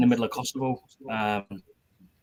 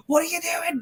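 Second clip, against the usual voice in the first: high, breathless horrified question; stunned disbelief. Retold or imitated. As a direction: gasp the question out in horror. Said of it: "I was like".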